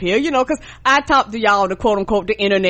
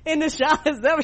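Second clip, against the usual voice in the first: mimicking cousin; 6 semitones higher than usual. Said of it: "she was like".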